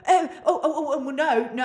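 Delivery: high, stammering and laughing nervously; a caller caught out, backpedalling; flustered caller. Retold or imitated. Caught-out claims caller backpedalling, high and flustered. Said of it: "And then they go".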